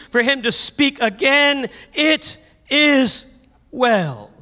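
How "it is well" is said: very slow, drawn-out it is well; slow declaration. Acted. Preacher slowing down to declare faith to the congregation. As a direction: stretch out "It is well" slowly with weight on "is". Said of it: "That's an Emmanuel spirit".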